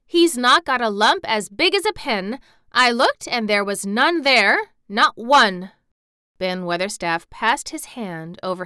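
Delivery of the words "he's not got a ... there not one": loud, raised, insistent denial; indignant child defending a friend; fierce indignation. A narrator's reading. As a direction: argue it almost at a shout. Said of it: "almost shouting up at the wall in her fierce indignation".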